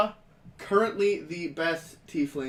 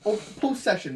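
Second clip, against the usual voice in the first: normal talking voice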